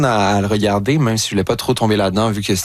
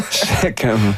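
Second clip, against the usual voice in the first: laughing; 2 semitones higher, slower than usual. Real, not acted. Actor recalling scenes he couldn't get through, laughing. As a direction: let a laugh swallow the words.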